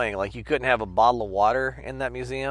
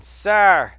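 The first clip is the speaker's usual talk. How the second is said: loud, higher repeated call across the room; calling out sir; 8 semitones higher, 14 dB louder, much slower than usual. Retold or imitated. Museum guard calling a visitor, loud higher call. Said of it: "hear someone going".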